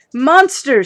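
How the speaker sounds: high, loud horrified cry. Acted. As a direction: shriek it in alarm. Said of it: "she cried".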